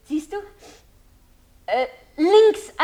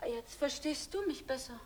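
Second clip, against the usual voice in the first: quiet; 15 dB quieter than usual. Acted. Line clears, talks softly.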